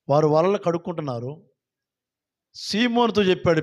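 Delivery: normal talking voice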